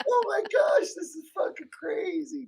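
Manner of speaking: very high-pitched comic outburst; mock yelling. Player reacting to paladin's antics, high mock outrage. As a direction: cry out in playful disbelief. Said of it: "like, yell at her".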